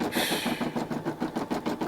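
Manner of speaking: long, soft exhale; long sigh. Real, not acted. Host recalling gig work opportunities, long soft sigh. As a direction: let out a slow, airy sigh.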